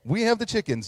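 proud. Retold or imitated. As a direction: puff up and announce it. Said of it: "you said that proudly".

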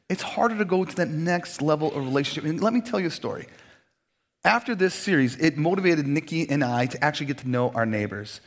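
ordinary talk, nothing marked

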